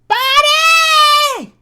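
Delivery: put-on very high drunken holler; loud and exaggerated. Tipsy patron calling Bonnie across the tavern, loud and exaggerated. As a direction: holler it at full volume, drawn out. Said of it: "now loud and exaggerated".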